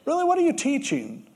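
Jesus gently scolding Nicodemus; mildly hard on him. Retold or imitated. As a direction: needle the teacher a little. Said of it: "Jesus is kind of hard on him".

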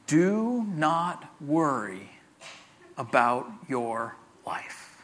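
very slow, drawn out, dropping low and soft; read real slow. Shown as a demonstration. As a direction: linger on every word. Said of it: "I'm gonna read it real slow".

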